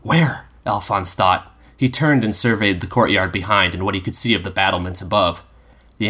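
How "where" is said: 1 surprised. A narrator's reading. Alphonse startled, looking around.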